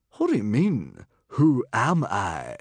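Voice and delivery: loud, incredulous, drawn-out question; extremely surprised. A narrator's reading. Famous hero is asked his name, incredulous. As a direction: echo it in disbelief. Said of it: "looked extremely surprised".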